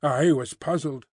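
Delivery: ordinary delivery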